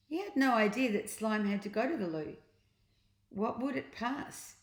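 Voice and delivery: plain reading voice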